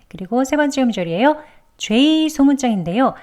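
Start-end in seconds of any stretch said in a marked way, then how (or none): none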